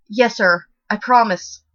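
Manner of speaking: loud, shaky frightened stutter. A narrator's reading. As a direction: stammer out a terrified promise. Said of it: "I stuttered out".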